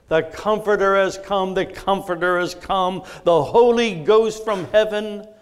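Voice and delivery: emphatic, ringing proclamation; hymn chorus declaimed. Real, not acted. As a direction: proclaim it with mounting fervour. Recites the hymn's chorus.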